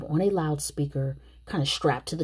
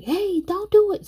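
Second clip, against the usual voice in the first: high, loud mock warning; acted-out urgent call, pitched way up; 5 dB louder than usual. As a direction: shout it like trying to stop someone. Acts out Julius warning Kelsey.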